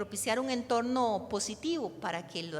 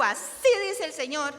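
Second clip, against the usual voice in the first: loud, higher prophetic declaration; profeta tajante; 6 semitones higher, 8 dB louder than usual. Shown as a demonstration. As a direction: declare it forcefully as God's own word. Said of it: "Pero si yo digo". (declaración profética fuerte y aguda)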